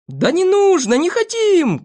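high, loud drawn-out refusal; shouting. A narrator's reading. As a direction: wail a protest at top pitch. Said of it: "кричали остальные". (громкие протестующие крики)

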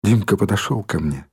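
ordinary delivery, nothing marked

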